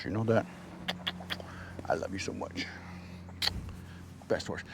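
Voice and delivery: soft, low loving murmur; tender pet talk. Real, not acted. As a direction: coo to the horse you love.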